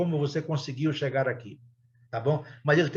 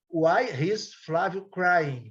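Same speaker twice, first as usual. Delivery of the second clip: every word spaced out for learners; slow model question; 3 semitones higher, much slower than usual. Shown as a demonstration. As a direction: say it slowly as a worked example. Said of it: "Eu posso colocar". (exemplo lido devagar pela professora)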